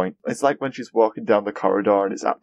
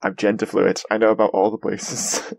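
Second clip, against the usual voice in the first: usual speaking voice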